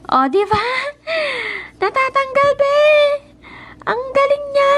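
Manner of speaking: high, loud delighted exclaim; thrilled the stain came off; excited. Real, not acted. (masayang tili, mataas at malakas)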